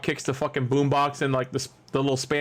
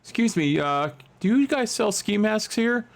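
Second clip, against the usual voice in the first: polite customer voice; 7 semitones higher, slower than usual. Pretend. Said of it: "I'm gonna walk in Walmart".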